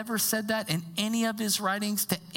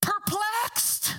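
perplexed; 8 semitones higher than usual. Pretend. Punchline word repeated for effect.

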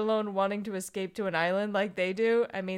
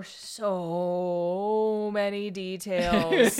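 SO stretched out long and loud; drawn-out emphasis; 5 dB louder, much slower than usual. Real, not acted. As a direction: draw out "so" at length before "many details". Exclaims over the book's details.

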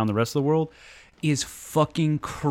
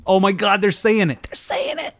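excited laughing; 7 semitones higher than usual. Real, not acted.

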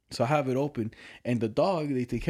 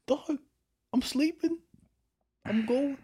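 high, whining plea, much higher; whiny sleepy protest; 13 semitones higher, slower than usual. Retold or imitated. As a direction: beg the dog to let you sleep. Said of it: "I was like".

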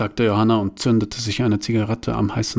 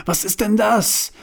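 alarmed; 11 semitones higher than usual. Acted. Engine explodes, alarmed question.

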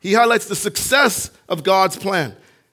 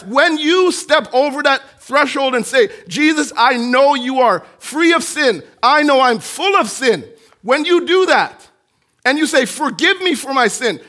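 loud, high preacher building to a peak; fervent sermon climax; preaching at full force; 8 semitones higher, 8 dB louder than usual. Acted. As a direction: drive it home to the congregation.

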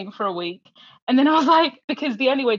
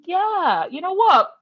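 high, loud, breezy encouragement; mock easygoing cheer; imitated upbeat recruiter; 9 semitones higher, 6 dB louder than usual. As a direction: invite them in with exaggerated enthusiasm. Acting out the course director's invitation.

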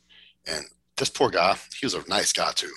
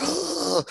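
a sudden high strangled groan; the cramping winner falling off the machine, high groan; imitated groan. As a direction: yelp like a man whose legs just cramped. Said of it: "He's like, he's like".

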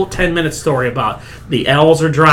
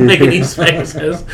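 ordinary delivery

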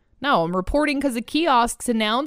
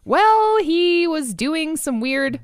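surprised; 8 semitones higher, 3 dB louder, much slower than usual. Real, not acted. Startled by Philippe.

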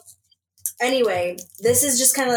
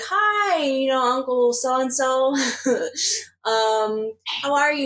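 higher, sing-song polite hello to an uncle; sweet high greeting; 5 semitones higher than usual. Retold or imitated. Calling uncle to share college news, sweetly high. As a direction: put on a sugary nice-niece voice. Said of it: "I go, like".

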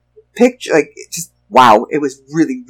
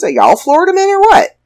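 incredulous; 14 semitones higher, 3 dB louder than usual. Real, not acted. Mocking incredulous question.

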